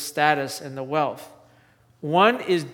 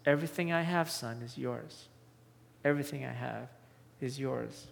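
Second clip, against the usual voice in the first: much softer, tender father; kind, gentle; 4 semitones lower, 11 dB quieter than usual. Retold or imitated. Father gently reassuring his angry son, soft and tender. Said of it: "he responds with kindness and gentleness".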